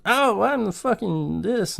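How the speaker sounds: hammed-up whiny clown; mock clown boast. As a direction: lampoon the clown bragging. Mocking Pennywise's boast.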